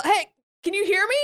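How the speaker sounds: high-pitched call-out; pretend yell. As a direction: call out to check you are heard. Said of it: "I yell".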